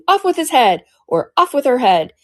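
loud high royal shout; shouting queen. Acted. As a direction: bellow the order up high.

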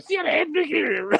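put-on high, slow, garbled South African accent; mock your own terrible South African attempt; gibberishy fake South African. Podcaster mocking how wrong he got it, gibberishy South African. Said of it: "just a gibberishy voice".

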